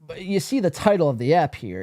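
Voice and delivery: usual speaking voice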